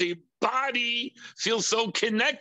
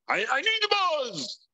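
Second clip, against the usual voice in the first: craving addict; 10 semitones higher than usual. Retold or imitated. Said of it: "every addict who takes".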